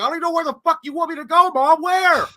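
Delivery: high, loud exasperated shouting; flustered yelling back. Retold or imitated. Child asking screaming mom where to go, exasperated yell. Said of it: "she kept yelling at me".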